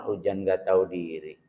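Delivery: low, soft sulky complaint; muttered grumble. Retold or imitated. Host complains as rain falls on his event, muttered grumble.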